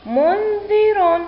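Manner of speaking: منذر joined as one slow, high word; teacher's high, slow whole-word model; blended word reading. Shown as a demonstration. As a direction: run the syllables together, drawn out and clear. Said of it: "لنقرأ الكلمة".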